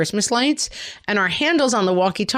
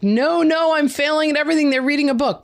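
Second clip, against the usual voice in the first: mock panic; 7 semitones higher than usual. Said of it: "and I'm like".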